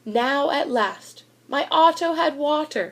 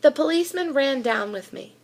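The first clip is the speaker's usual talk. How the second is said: plain reading voice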